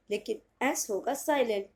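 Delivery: ordinary delivery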